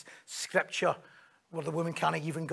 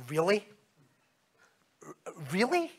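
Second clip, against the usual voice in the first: rising, doubtful query; sceptical "Really?"; 2 semitones higher than usual. Shown as a demonstration. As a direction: question their plan incredulously. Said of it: "we'll maybe go like".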